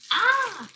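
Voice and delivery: sudden high yelp; a startled high squeal; surprised yelp. Real, not acted. Sudden cry while cooking.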